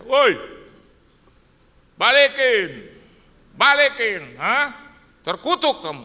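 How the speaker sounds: loud, high, hammed-up debt collector shout; mock angry yelling. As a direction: yell a demand as if cursing a debtor. Acts out angry debt demand never made. (berteriak marah pura-pura menagih utang)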